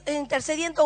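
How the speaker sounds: ordinary talk, nothing marked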